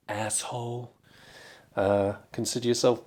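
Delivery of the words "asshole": muttered aside. Acted. Quiet aside to viewers about Farage. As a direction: spit it out quietly as a running gag. Recurring muttered insult gag.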